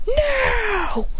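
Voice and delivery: high, stretched cry of refusal; dramatic cry. Acted. Fighter throws a smoke bomb, dramatic high cry. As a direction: cry it out high, anime style. Fighter cries out mid-battle.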